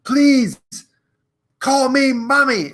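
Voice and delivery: hammed-up high, desperate plea; straining movie hero. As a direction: beg while straining to lift. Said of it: "he's trying to lift, and he's like".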